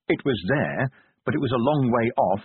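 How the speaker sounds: ordinary delivery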